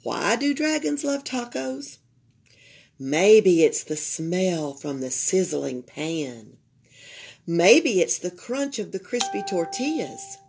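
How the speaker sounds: ordinary delivery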